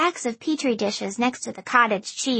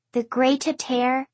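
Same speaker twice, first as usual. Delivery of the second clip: plain reading voice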